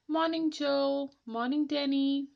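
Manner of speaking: high, bright greeting voice; cheerful villager greeting. Acted. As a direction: call out a friendly good morning.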